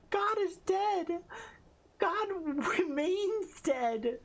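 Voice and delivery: high cutesy uwu voice; squeaky uwu reading; cutesy baby talk. Acted. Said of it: "Uwu voice?".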